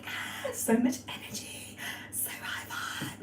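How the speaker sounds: mock-blissed breathy gush, hushed; sarcastic. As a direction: sigh it out in airy fake rapture. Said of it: "I'm being a bit sarcastic there".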